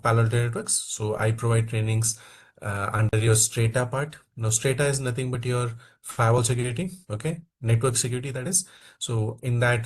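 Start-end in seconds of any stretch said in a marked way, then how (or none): none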